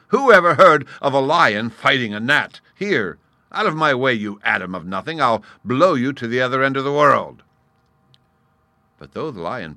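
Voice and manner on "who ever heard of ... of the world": loud, raised-pitch indignation; blustering anger. Acted. Lion threatening to blow the gnat away, blustering angrily. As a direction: order the pest away at full bluster.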